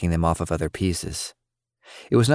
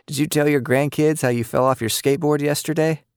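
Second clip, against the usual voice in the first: teasing; 9 semitones higher than usual. A narrator's reading. Teasing her husband.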